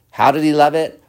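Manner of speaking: ordinary delivery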